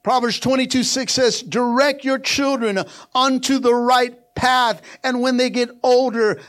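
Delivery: loud, high-pitched sermon building in force; full-force preaching. Real, not acted. Preacher urging parents that prodigals will come back, full-force preaching. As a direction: preach it hard, driving each phrase home.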